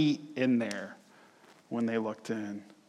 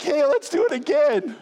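high, loud imitated thrill-seeker; hammed-up eagerness, pitched way up; mock giddy excitement; 12 semitones higher, 6 dB louder than usual. Preacher mimicking riders after the ride, giddy mock excitement.

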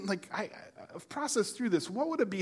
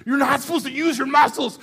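high, loud scolding wife voice; wife scolding him to lie still, higher and louder; imitating wife; 10 semitones higher, 12 dB louder than usual. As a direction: mimic her nagging him, pitched up. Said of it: "and she's like".

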